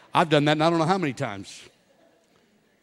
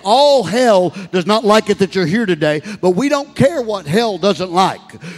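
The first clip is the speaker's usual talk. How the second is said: loud, higher preaching voice building in intensity; full force preaching; 5 semitones higher, 8 dB louder than usual. Acted. Pastor rallying the congregation against hell, loud and higher. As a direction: preach it hard to rouse the room.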